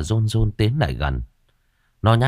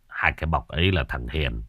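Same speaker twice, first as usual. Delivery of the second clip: sad father; 5 semitones lower than usual. A narrator's reading. Father answering his son about the bodies, sad and low. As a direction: speak heavy with sorrow, voice sinking. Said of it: "cha của nó buồn bã".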